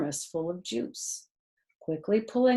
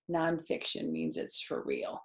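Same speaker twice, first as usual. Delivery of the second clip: ordinary delivery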